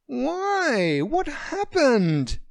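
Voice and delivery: high, loud, slow worried questions; shocked and anxious to know; alarmed surprise. Acted. Friend on the phone, alarmed and surprised.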